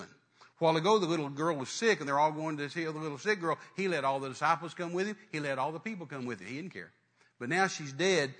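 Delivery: normal talking voice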